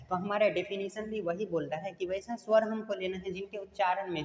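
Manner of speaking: ordinary delivery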